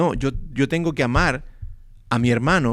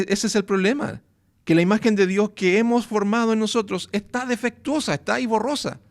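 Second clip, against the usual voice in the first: higher, driving preacher's voice; rising fervent preaching; 5 semitones higher than usual. Acted. Preacher urging the congregation, rising and fervent. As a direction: press the point home with mounting fervour.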